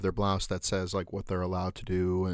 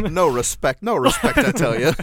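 higher, louder laughing comedian whine; Rodney Dangerfield; 8 semitones higher, 10 dB louder than usual. Retold or imitated. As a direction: play the put-upon stand-up comic. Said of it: "Rodney, Rodney Dangerfield?".

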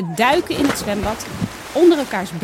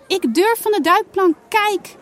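calling out excitedly; 10 semitones higher, 4 dB louder than usual. Acted. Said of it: "roept Janna".

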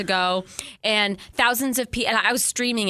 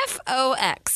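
lightly voiced child, higher pitch; a hint of a small boy spelling, pitched up; little kid; 5 semitones higher, slower than usual. Podcaster correcting friend about movie's kid, lightly imitated child voice. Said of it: "the little kid was just like".

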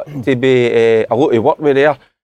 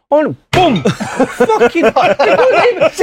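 laughing mimicry; 11 semitones higher, much slower than usual. Real, not acted. Mimics a hard landing, laughing.

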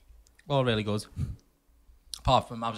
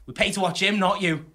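snippy woman; 6 semitones higher, 5 dB louder than usual. Retold or imitated. Woman telling him off retold.

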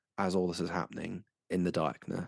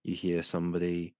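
ordinary delivery

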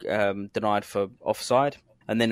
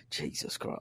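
muttered complaint; 12 dB quieter than usual. Retold or imitated.

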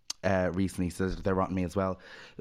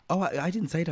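higher, stumbling nervous denial; flustered denial; 9 semitones higher than usual. Retold or imitated. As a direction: backpedal in a fluster. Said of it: "she's like".

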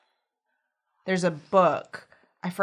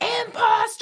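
very high, loud screamed accusation; hammed-up furious yell of accusation; accusing shriek; 5 dB louder, slower than usual. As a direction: scream it at the child like she is a fake. Mother voiced attacking her daughter.

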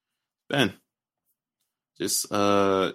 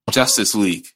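ordinary delivery

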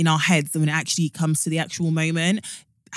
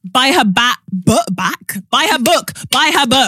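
louder, higher hype call; hype plug; 6 semitones higher, 7 dB louder, slower than usual. Real, not acted. Cohost loudly plugging the host's book. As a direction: push the book like a hype man.

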